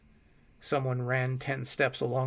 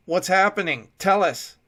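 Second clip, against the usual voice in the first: loud, higher urgent demand; demanding shout; 6 semitones higher, 10 dB louder than usual. A narrator's reading. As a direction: demand an answer at once. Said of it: "Will demanded".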